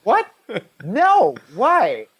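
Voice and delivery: mock shocked, high and loud; high startled squawk of a surgeon; shocked doctors. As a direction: play the stunned doctor, pitch jumping up. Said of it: "they're like".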